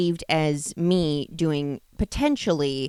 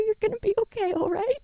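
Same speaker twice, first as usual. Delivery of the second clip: soothing; 13 semitones higher, 6 dB quieter, faster than usual. Acted. Soothing partner mid-scene.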